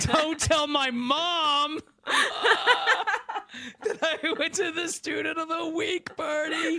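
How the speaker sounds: high, laughing through the words; high-pitched laughing plea; laughing confession. Real, not acted. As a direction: giggle out the confession, slow.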